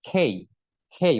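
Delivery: "খেই" repeated with a puffed খ; aspirated K. Shown as a demonstration. Teacher drilling the letter K, "খেই" with aspirated খ. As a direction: say "খেই" with air after the K, gliding into ই. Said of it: "মানে খর উচ্চারণ হবে".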